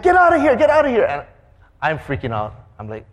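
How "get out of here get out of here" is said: high, loud urgent plea to drive; panicked yell. Retold or imitated. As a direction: shout as the van speeds away. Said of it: "We were like".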